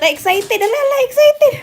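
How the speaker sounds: high, loud excited squeal. Real, not acted. Hyping the excited dog.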